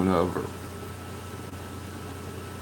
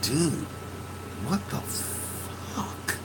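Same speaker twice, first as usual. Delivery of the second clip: slow, breathless, whispered disbelief; stunned hush; 5 semitones higher, 12 dB quieter, much slower than usual. Retold or imitated. To friend on the floor, a hushed stunned what the fuck. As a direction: let it out in shocked disbelief. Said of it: "I'm like".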